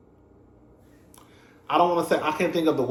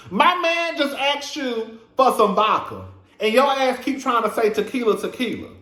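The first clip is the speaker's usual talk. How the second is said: exasperated rant; 7 semitones higher than usual. Real, not acted. Ranting at the bartender about the vodka. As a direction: drag it out like you can't believe it. Repeats rant, slower and higher.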